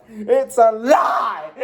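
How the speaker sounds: slow, cracking hammed-up shout; mad-scientist yell. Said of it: "Sorry if I'm getting too loud".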